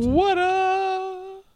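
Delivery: very high, long-held shout; squeaky hype call. Pretend. Co-host hypes each beer announced, high squeal.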